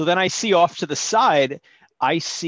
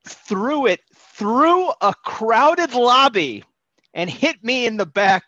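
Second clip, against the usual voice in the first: incredulous emphasis; 8 semitones higher, 5 dB louder, slower than usual. Real, not acted. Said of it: "manager threw guacamole".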